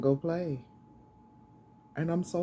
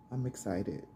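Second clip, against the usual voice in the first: softer, inward repeat; quiet; 7 semitones lower, 5 dB quieter than usual. Real, not acted. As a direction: say it again softly to yourself.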